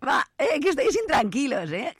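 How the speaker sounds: cheeky mock scolding; teasing. Real, not acted. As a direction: poke fun at the listeners. Teasing listeners, laughing.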